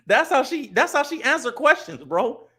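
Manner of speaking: high-pitched, incredulous complaint; exasperated. Real, not acted. Streamer complaining to viewers about her answers, exasperated.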